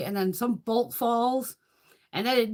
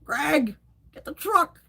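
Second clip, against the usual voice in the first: excited call; 7 semitones higher, 4 dB louder, slower than usual. Retold or imitated. Calling to Greg in retelling.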